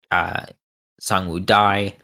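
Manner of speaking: usual speaking voice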